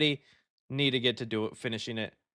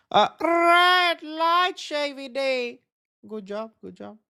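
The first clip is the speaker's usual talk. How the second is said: Red Light voice; 9 semitones higher, slower than usual. Pretend. Viewer's birthday request, high slow character voice. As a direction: boom the character's name out. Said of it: "say happy birthday in the Red Lights voice".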